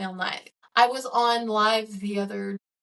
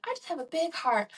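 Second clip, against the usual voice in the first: a light, higher, sugary church-lady take on "I just have a big heart"; mock church person; 7 semitones higher than usual. Vlogger to her viewers, mocking church people, higher sweet mock piety. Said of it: "to sound like one of those church people".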